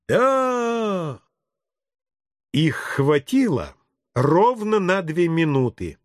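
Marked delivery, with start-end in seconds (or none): 0.1-1.2 put-on high, drawn-out kids' cry; children's chorus. Children agreeing with the policeman, drawn-out high chorus. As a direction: draw it out high like an agreeing class.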